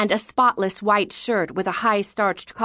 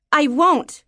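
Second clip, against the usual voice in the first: put-on higher-pitched stubborn girl; defiant child; 7 semitones higher, 4 dB louder than usual. Daughter refusing her father, defiant. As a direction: refuse outright in a raised child's voice.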